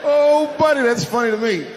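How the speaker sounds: high and loud, cracking up; laughing loudly. Real, not acted. Comedian laughing at his own joke, high and loud.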